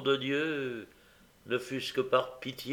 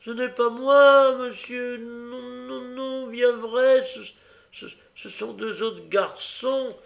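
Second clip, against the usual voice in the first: put-on high pleading child voice; the boy pleading innocence to the policeman; desperate pleading; 11 semitones higher, 6 dB louder, slower than usual. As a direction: beg in panic, wringing hands. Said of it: "se tordant les mains avec désespoir".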